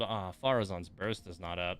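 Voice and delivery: ordinary delivery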